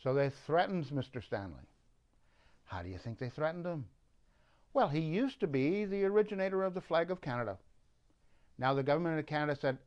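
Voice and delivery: usual speaking voice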